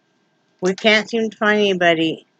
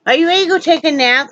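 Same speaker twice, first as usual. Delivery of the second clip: pet talk; 7 semitones higher, faster than usual. Acted. Talking to the dog.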